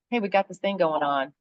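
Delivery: ordinary talk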